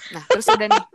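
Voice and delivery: laughing. Real, not acted. Laughing while telling.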